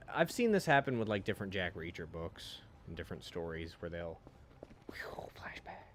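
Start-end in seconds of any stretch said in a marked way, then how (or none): 4.9-5.8 whisper. Pretend. Mock dramatic flashback whisper.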